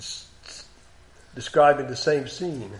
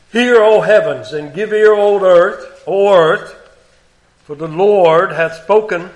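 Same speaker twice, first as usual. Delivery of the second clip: raised, louder proclaiming of scripture; solemn scripture reading; 3 semitones higher, 7 dB louder than usual. Acted. Preacher reading God's complaint aloud, solemn and raised. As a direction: proclaim it like God calling heaven and earth.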